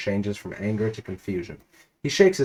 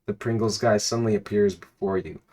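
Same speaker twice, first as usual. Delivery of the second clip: ordinary delivery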